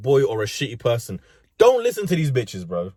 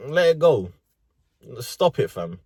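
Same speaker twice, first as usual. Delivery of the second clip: usual speaking voice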